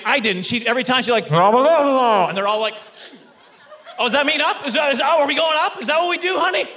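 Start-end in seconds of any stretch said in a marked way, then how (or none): 1.3-2.3 loud wordless mwah-wah garble; booming cartoon trombone talk; Charlie Brown's teacher. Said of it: "instructor is, uh, Charlie Brown's teacher". 4.0-6.7 high-pitched, hurried comic confusion; hammed-up bewildered pleading; confused questioning. Acts out confused class member guessing.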